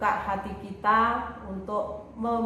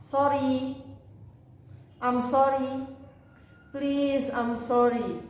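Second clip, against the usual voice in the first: clear, raised teacher model voice for the class to copy; model phrases to repeat; 4 semitones higher than usual. Shown as a demonstration. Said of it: "bisa ditirukan ucapan saya". (contoh ucapan guru untuk ditirukan)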